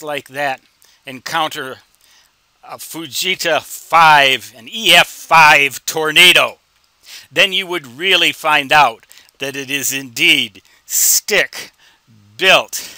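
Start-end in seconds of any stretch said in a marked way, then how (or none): 2.6-12.9 loud, slow mock-ominous build about the tornado; mock dramatic. Ham radio host jokes to viewers about a tornado, mock dramatic and loud. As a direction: boom it out like a disaster trailer.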